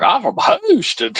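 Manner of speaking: imitated country accent. Explaining the movie joke to friend, high mock country accent. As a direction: say it like the movie's Chinese cowboy. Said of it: "kinda like this country accent".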